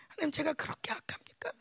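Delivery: breathy whisper, pleading with God; whispered plea. Retold or imitated. Pastor repeating his lament to God, whispered. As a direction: hush the repeat into a desperate whisper.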